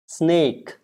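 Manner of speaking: SNAKE with long AY and a released K; model pronunciation. Shown as a demonstration. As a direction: sound out snake, K from the throat. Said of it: "The K has to come from your throat".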